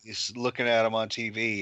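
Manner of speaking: normal talking voice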